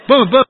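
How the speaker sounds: loud, high "boom, boom"; emphatic thump noises; imitated back thumps. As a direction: punch out two thumping sound effects. Imitates hard pats on the back.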